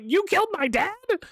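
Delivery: high squeaky imitation of the character; high mock-accusing voice. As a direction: mimic him in a high mocking squeak. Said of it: "He's like".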